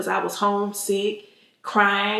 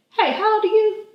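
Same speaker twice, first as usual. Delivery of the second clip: teasing mom; 11 semitones higher than usual. Retold or imitated. Retold mom teasing daughter.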